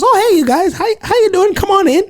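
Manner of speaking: high-pitched, chirpy imitation of a welcoming lady; friendly European lady. Imitating a lady welcoming guys into the elevator, friendly and high-pitched. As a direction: imitate her greeting way up high, sing-song. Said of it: "she wasn't from here from her accent".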